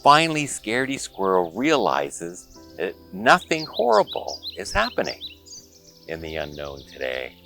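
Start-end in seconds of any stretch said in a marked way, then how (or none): none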